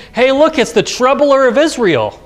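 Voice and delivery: mock Ahab greeting his enemy with scorn; a king's scornful jab at a prophet, pitched high; sneering jibe. Preacher voices Ahab's jibe.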